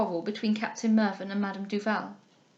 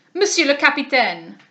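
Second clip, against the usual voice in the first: louder, offended French cry; indignant cry; 3 semitones higher, 8 dB louder than usual. A narrator's reading. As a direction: demand satisfaction, loudly. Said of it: "cried Monsieur Dubois".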